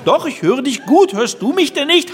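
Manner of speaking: high, loud reply. Retold or imitated. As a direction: call back over a bad line.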